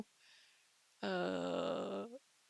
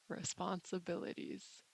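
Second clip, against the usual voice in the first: usual speaking voice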